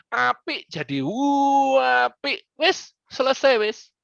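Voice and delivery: "wapi" and "wis" said high and slow, with the i drawn out; playful Javanese. Shown as a demonstration. Coach joking about Javanese to a pupil, high and slow. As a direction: stretch it high and slow, teasing. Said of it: "ditambah U depannya, I-nya dipanjangin".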